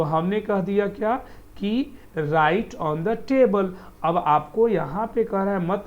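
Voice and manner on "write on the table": slow model reading. Shown as a demonstration. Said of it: "teacher models sentence slowly".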